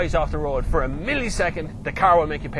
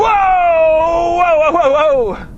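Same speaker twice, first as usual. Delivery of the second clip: long, loud, high wailing whoa; startled whoop; 13 dB louder, much slower than usual. Real, not acted. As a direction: yell as the car slides. Car slides on a corner.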